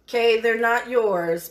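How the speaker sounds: ordinary talk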